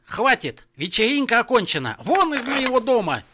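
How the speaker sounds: high, loud angry outburst; barked. A narrator's reading. Father throws the musicians out, barking furiously. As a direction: bellow them out of the house. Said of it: "рявкнул он".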